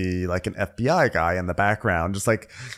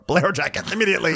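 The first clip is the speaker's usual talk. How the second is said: rushed, higher mock demand; quick, hammed-up frantic order; mock panic; 7 semitones higher, faster than usual. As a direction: rattle it out like a comic emergency.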